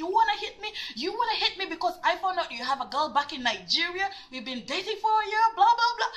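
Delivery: high, indignant retold tirade; outraged retort. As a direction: fire back in mock fury, voice climbing. Retold angry retort to boyfriend.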